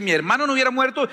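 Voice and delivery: a quick, lifted complaint, "hubiera" stressed; quick reproach. Shown as a demonstration. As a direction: rattle off "Mi hermano no hubiera muerto" like a complaint. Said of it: "la diferencia entre las dos oraciones".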